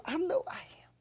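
whispered, rising at the end. Real, not acted. Believer whispering a personal claim of strength. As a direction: breathe it to yourself, unvoiced. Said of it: "You say you're strong".

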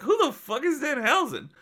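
high, incredulous retold cry; baffled outburst. Recalling the match reveal to his cohost, high incredulous cry. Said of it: "I was like".

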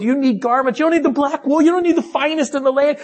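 high, urgent preacher's run; impassioned preaching. Acted. Preacher urging listeners about their true clothing, impassioned. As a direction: drive the contrast home, pitched high.

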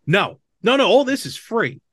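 ordinary talk, nothing marked

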